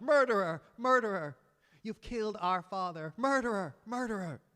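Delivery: loud, high furious yelling of an accusation; accusing shout. Acted. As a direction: scream it at the killer with all you have. Sons accusing the killer.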